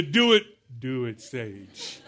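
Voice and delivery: sinking low, winding down; worn-out older stage. Acted. Preacher mimics his own aging stage, sagging and weary. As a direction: let the pitch sag and fade by the end. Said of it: "Some of us are at the".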